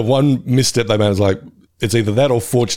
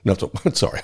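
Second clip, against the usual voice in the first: usual speaking voice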